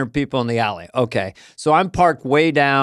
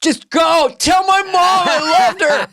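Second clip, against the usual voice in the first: much higher, slow, hammed-up dying words; mock heroic sacrifice; 14 semitones higher, 3 dB louder, slower than usual. Producer left behind by the mob, hammed-up heroic farewell. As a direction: ham it up like a fallen soldier.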